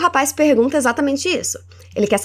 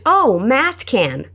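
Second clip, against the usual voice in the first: drawn out very slowly for learners; deliberate slow teaching read; slow model phrase; much slower than usual. Shown as a demonstration. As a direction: say it slowly so they can copy.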